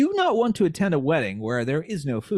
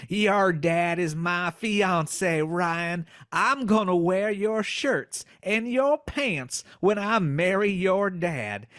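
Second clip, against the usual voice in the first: slow, high Southern drawl, rising higher; sly silky fox with a twang; 5 semitones higher, slower than usual. Acted. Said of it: "twang for the voice of the fox".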